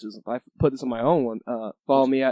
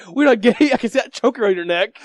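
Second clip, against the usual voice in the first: mock yell; 8 semitones higher, 5 dB louder than usual. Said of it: "he's like".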